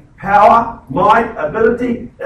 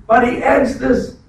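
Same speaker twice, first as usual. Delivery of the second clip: usual speaking voice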